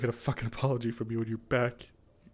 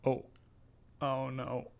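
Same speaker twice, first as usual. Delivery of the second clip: ordinary delivery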